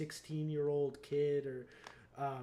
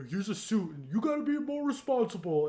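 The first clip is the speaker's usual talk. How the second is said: loud put-on lecturing voice, rising higher; mock a mentor's scolding, pitched up; Iron Man imitation; 6 semitones higher, 7 dB louder than usual. Iron Man handing Spider-Man a suit, mock lecture.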